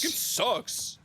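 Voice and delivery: high mock whine; whiny gamer. Mocking gamer complaint.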